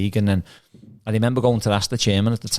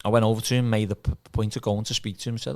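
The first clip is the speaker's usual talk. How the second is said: ordinary delivery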